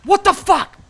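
high, loud disbelieving yell; shocked shout. Real, not acted. Gamer stunned by the game, yelling high and loud. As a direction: blurt it out in total shock.

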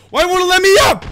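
high, loud exasperated yell; strained high shout; frustrated yell. Real, not acted. Frustrated gamer stuck downed.

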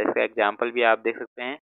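ordinary delivery

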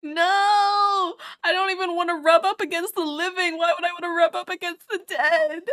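high, loud, insistent protest; indignant protest. Real, not acted. Denying any wish to touch the dead, indignant protest. Protests teasing about rubbing corpses.

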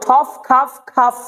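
slow ঠফ খাফ with airy f endings; P turned to F. Shown as a demonstration. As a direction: end ঠফ and খাফ on a released ফ. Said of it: "প কে ফ করতে হবে".

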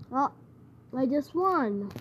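ordinary talk

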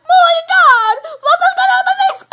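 high loud shriek, half laughing; shrieking in pain. Pretend. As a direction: shriek about your face. Toy hit in the face.

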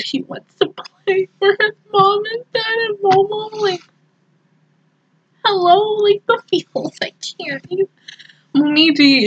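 high, tearful voice; choking up. Real, not acted. Fan moved by the boy's devotion, tearful and high. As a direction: let the voice climb as tears come.

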